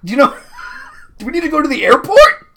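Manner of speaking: high, loud laughing shout; panicked laughing outburst. Acted.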